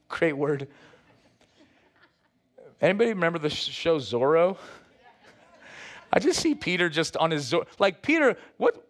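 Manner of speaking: normal talking voice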